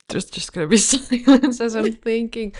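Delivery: amused, laughing mid-sentence. Real, not acted. Laughs at own thinking silence.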